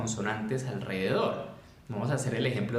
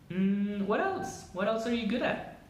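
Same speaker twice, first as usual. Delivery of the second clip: usual speaking voice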